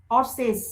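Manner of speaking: হর্সেস said as two syllables, hor-ses, with a clear s-es ending; pronunciation demo. Shown as a demonstration. Teacher shows students the plural ending in হর্সেস. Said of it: "পুরো উচ্চারণটাই হর্সেস হয়ে গেল".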